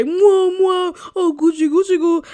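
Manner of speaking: mocking how he kisses her like a baby; baby talk. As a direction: coo it like a doting parent. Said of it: "like you're about to say".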